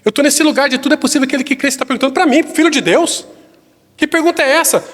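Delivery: high, insistent rebuke; Jesus, indignant. Acted. Preacher as Jesus asking the father "para mim?", indignant.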